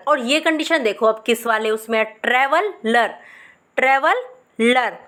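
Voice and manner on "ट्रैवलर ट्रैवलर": Traveller drawn out slowly; slow teaching. Shown as a demonstration. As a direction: model the word slowly for students. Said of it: "सबसे पहले बोलो". (धीरे धीरे साफ़ उच्चारण)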